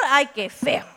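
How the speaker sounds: laughing disgust. Real, not acted. Reacción a la gallina.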